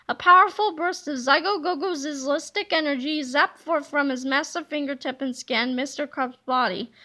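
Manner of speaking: usual speaking voice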